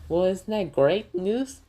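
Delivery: high, slow mocking gloat; gloating uncle. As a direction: savor their misery. Uncle gloats over the burning.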